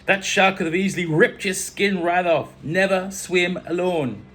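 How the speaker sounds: loud, high put-on Caribbean scolding; bad Caribbean accent. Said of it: "Bad accent".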